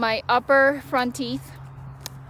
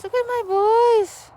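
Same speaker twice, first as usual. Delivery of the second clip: doting pet voice; 10 semitones higher than usual. Real, not acted. Owner cooing to her dogs playing, high doting voice. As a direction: coo at the dogs like a proud parent.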